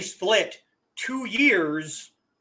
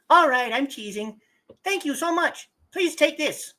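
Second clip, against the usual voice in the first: flirty high female voice; 8 semitones higher than usual. Acted. Voicing flirtatious female character.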